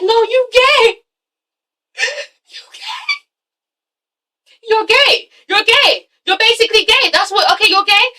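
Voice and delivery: high, laughing mock insistence; mocking sisters. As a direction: squeal it at him like teasing sisters. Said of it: "they're like".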